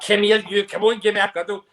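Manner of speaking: lightly voiced playful husband begging a cuddle; a hint of teasing coaxing.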